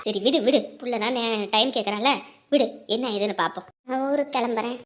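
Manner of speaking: low, soft elder voice giving advice; coaxing elder. Acted. Elder coaxing the girl to accept marriage, low and soothing. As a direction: gently lecture the girl with proverbs.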